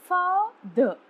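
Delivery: "father" with FA long, high and loud, "ther" dropped; stress on first syllable. Shown as a demonstration. Teacher showing students a stressed syllable, FA stressed in "father". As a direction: hit FA hard in "father", let the ending fall away. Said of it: "Fa is a stressed syllable and the is unstressed".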